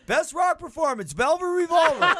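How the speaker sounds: high, showy award announcer. Retold or imitated. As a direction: call it out like a presenter on stage. [name] announcing the award.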